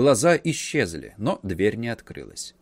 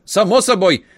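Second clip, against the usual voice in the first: eager uncle; 6 semitones higher, 5 dB louder than usual. A narrator's reading. Uncle eager, pressing the nephew.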